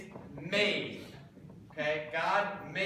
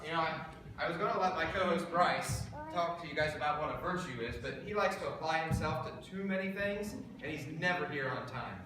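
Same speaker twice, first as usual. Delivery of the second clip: ordinary talk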